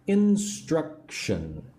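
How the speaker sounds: very slow "Instruction", each syllable apart; teacher's three-part "Instruction". Shown as a demonstration. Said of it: "Three parts".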